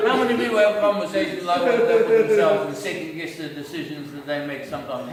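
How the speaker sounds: ordinary talk, nothing marked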